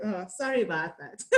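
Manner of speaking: apologising through a laugh; a sorry that breaks into laughter; giggly sorry with the words still clear; laughing apology. Real, not acted. Laughs at her own accent slip.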